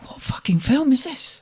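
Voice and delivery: hushed incredulous whisper; whispered thought. Retold or imitated. To himself at the ice bar film, hushed whisper. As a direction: whisper it to yourself in disbelief.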